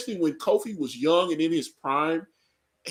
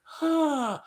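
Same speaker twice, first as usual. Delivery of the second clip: unimpressed huh; 11 semitones higher than usual. Retold or imitated. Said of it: "I was like".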